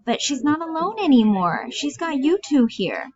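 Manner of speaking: high-pitched aunt voice, slowing at the end; anxious Bella. Acted. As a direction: protest in a high, childlike voice. Plays Bella.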